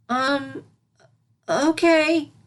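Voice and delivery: high, unsure little voice, then a brave okay; timid kid working up courage; hesitant filly. Acted. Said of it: "the little unicorn hesitated".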